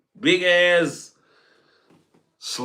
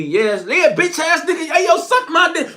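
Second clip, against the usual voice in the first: much higher, hammed-up taunting voice; mock New York hustler; 12 semitones higher than usual. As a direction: parody a street guy taunting you, pitched way up.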